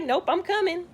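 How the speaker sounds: light pretend newborn voice; playful baby voice. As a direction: voice the newborn with cheeky insistence. Baby's voice imagined at birth.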